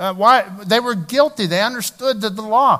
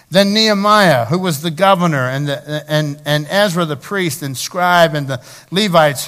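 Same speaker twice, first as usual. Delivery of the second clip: scripture reading; 5 dB louder than usual. Acted. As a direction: proclaim it to the whole hall. Pastor reads Nehemiah aloud.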